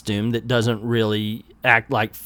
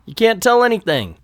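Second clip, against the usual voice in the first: ordinary delivery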